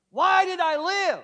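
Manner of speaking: high, loud, anguished cry to heaven. Real, not acted. Preacher crying out to the congregation why he survived, anguished and loud.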